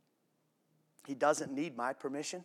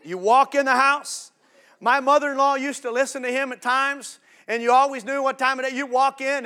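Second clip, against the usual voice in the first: loud, high, lively retelling; excited retelling; animated storytelling; 10 semitones higher, 10 dB louder than usual. Retold or imitated. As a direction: paint the scene with energy. Squirrely little voice.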